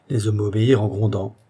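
usual speaking voice

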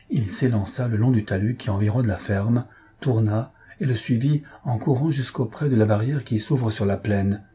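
ordinary delivery

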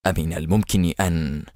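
low, uneasy murmur; understated nervous muttering; tense mutter. A narrator's reading. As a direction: trail off on a worried question. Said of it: "فتمتم في توتر".

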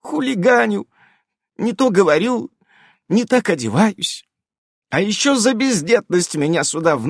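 lightly voiced high fox girl, eager to please; a nervous werefox pleading; hint of a fawning witch; anxious fox witch. A narrator's reading.

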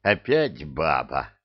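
low, slow, dismayed grumble; upset dismay. A narrator's reading. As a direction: drag it out in weary dismay. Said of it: "огорчённо всплеснул руками Веркит".

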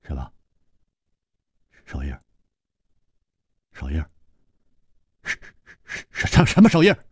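halting, stammered questions; flustered stammer. Acted. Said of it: "薛宝宝终于忍不住了".

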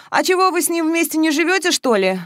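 high-pitched, would-be casual question; lightly put-on nonchalance; feigned indifference. A narrator's reading. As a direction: pretend not to care. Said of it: "вроде бы равнодушно спросила Маша".

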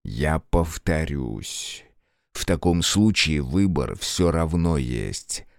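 slow, low, cold menace; hissing. Acted. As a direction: hiss each accusation slowly. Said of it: "прошипел Грех".

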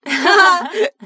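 short amused laugh at a funny name; burst of laughter. Real, not acted.

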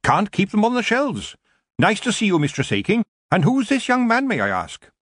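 put-on high, lively old witch; chatty witch shopkeeper. A narrator's reading. Witch shopkeeper welcomes her visitors, chatty and curious. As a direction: greet them in a high, inquisitive voice.

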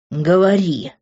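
low growled command from Grant; growled order. A narrator's reading. Man growls at girl, low command. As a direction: growl it into her face. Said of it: "зарычал он мне в лицо".

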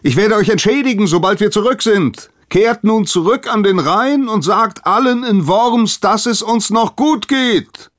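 high, loud call across the water; comforting call. A narrator's reading. King Gunther calls to the angry priest on the far shore, loud and soothing. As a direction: shout reassurance to the far bank. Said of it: "rief ihm König Gunther tröstend zu".

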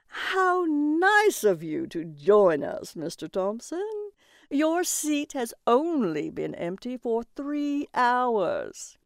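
slow, drawn-out announcer voice with rising and falling swoops; auctioneer on microphone. A narrator's reading. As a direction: stretch it out like an auctioneer addressing the room. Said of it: "the auctioneer said through the microphone".

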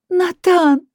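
high, loud surprised cry; sharp exclamation. Acted. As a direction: shout his name, startled. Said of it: "резко воскликнула я".

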